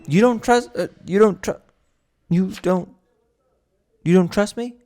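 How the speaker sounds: outraged at a baseless accusation; incredulous disbelief. Real, not acted. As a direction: sputter in disbelief. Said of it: "incredulous reaction to accusation".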